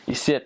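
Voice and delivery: "ici" ending in a hard T, like "icit". Shown as a demonstration. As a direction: close "ici" with an added T. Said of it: "they put a T at the end".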